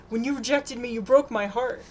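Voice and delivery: whining. A narrator's reading. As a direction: whine like a spurned boy. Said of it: "he whines".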